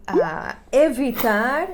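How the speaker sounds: normal talking voice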